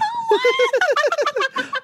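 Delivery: high-pitched burst of real laughter; helpless laughter. Real, not acted. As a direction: crack up completely, high and loud. Cracking up at failed accent.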